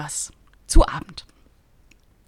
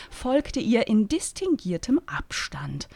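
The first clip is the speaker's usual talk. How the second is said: ordinary delivery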